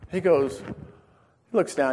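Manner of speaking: ordinary talk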